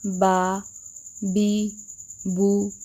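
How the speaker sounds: बा, बी, बू each kept short and even; short clean vowels. Shown as a demonstration. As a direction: say बा बी बू briefly without stretching. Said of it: "कैसी हरकत होगी".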